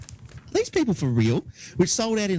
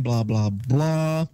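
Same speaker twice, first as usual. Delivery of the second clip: slow, bored blah blah blah; dismissive blah blah; 2 semitones lower, much slower than usual. Real, not acted. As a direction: brush it off lazily. Skipping boring press release text.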